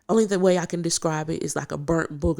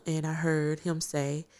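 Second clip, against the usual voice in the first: ordinary talk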